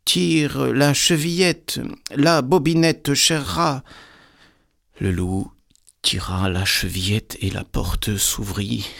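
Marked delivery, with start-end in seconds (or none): 0.0-3.8 high, slow, sing-out grandmother call. A narrator's reading. Grandmother in bed calling to the visitor, high calling voice. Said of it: "lui cria".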